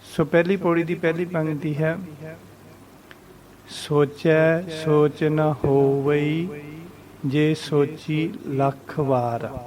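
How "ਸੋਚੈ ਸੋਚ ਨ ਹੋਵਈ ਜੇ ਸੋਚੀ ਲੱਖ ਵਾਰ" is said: slow recital with drawn-out vowels; measured recitation. Shown as a demonstration. Teacher reciting scripture for learners, slow and measured. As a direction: recite slowly, pausing between phrases. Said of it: "ਉਚਾਰਨ ਦੇ ਸਹਿਤ".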